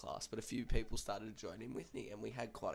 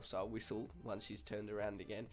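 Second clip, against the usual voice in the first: plain reading voice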